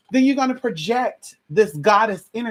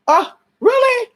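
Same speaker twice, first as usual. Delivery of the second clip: very high, slow, drawn-out disbelief; surprised; 4 dB louder than usual. Retold or imitated. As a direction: stretch it up high in mock surprise. Surprised reply to officer.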